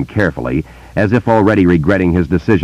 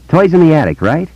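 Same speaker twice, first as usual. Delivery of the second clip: put-on higher, eager boy's voice; young boy; 12 semitones higher, 3 dB louder than usual. A narrator's reading. Boy telling Bill about voices, in a higher boy's voice. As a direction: voice a wary small boy telling a secret.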